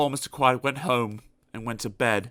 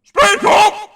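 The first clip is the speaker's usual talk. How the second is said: much higher, very loud command; shouted order; 13 dB louder than usual. Acted. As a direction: bark it at the customer. Grocer orders louder.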